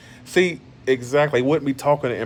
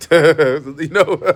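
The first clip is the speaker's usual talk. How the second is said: laughing; 4 semitones higher than usual. Real, not acted. Laughs at his own rant.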